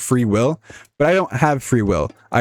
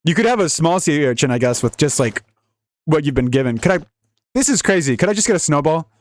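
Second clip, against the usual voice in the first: normal talking voice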